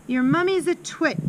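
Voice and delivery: high-pitched scornful bellow, only mildly loud. A narrator's reading. Headmistress insults Amanda's mother, bellowed. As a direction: hurl the insult at the child. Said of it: "the Trunchbull bellowed".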